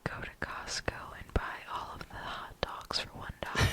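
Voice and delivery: hushed, unvoiced mock hypnotic whisper; subliminal whisper. Joking host planting a subliminal Costco order, whispered. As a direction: whisper it like a hidden message. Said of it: "Subliminal messaging".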